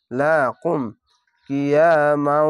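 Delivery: কিয়ামাউ read slowly, the vowel held one alif long; drawn-out vowel. Shown as a demonstration. Quran teacher showing students a slow, drawn-out vowel. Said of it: "এক আলিফ টেনে পড়বেন".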